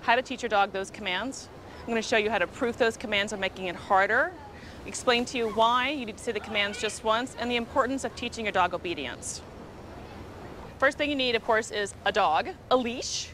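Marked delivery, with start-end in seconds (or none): none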